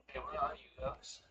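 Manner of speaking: ordinary talk, nothing marked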